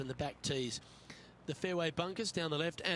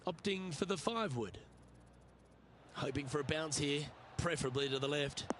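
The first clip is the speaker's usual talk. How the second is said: golf commentator; 3 dB quieter than usual. Acted.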